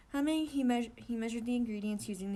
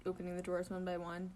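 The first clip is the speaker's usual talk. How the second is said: plain reading voice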